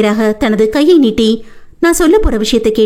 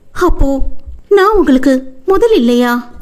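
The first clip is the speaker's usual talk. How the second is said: tearful; 6 semitones higher than usual. Acted. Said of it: "கண்ணீரோடு அவனை முறைத்தவள்".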